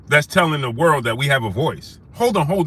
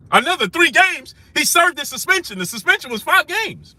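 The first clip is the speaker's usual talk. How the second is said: much higher, disbelieving; pitched way up in outrage at the suspension; incredulous; 12 semitones higher than usual. Real, not acted. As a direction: sound like you can't believe the extra games. Incredulous rant about suspension.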